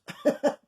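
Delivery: short, high real laugh; sudden high chuckle at himself; burst of laughter. Real, not acted. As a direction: break into a quick laugh. Laughs at own mispronunciation.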